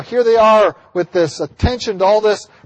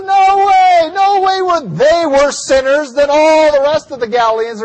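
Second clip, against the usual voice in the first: yelling; 10 semitones higher, 6 dB louder than usual. Acted. Jesus answering a multitude of Galileans, yelling. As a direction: shout it out over a crowd. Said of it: "I'm sure he was yelling at this point".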